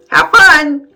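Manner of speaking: high, loud sing-out; cheery grandma. A narrator's reading. Grandma sending Wimberly to school, high cheery call. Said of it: "says Grandma".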